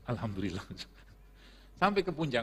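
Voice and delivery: normal talking voice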